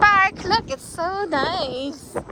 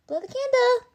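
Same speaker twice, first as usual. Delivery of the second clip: usual speaking voice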